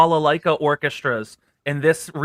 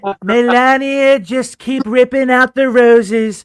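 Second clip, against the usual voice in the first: Trump impression; 4 semitones higher, 6 dB louder than usual. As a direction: bluster at Melania about the roses. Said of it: "and I do the Trump".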